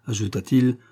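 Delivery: ordinary delivery, nothing marked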